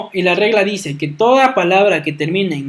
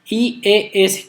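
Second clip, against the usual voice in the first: slow spelling; slower than usual. Shown as a demonstration. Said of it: "spelling out the ending".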